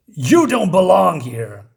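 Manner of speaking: slow, loud villain threat; menacing villain. Retold or imitated. Menacing villain line, comic retold.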